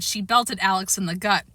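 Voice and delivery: usual speaking voice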